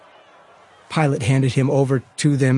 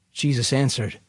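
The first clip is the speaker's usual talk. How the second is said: usual speaking voice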